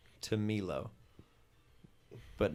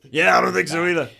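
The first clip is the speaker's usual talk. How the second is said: much higher, louder gang minion calling his boss; rough minion voice; 8 semitones higher, 16 dB louder, faster than usual. Acted. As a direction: shout it up to the boss like an eager henchman. Dez answers on radio.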